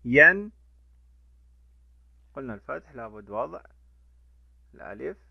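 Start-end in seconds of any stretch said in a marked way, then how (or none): 0.1-0.5 ين said as a short loud "an" ending; tanween sound. Shown as a demonstration. Teacher demonstrating fatha tanween to pupils, loud and clear. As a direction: sound out ين as the nunation "-an". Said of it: "تنوين بالفتح".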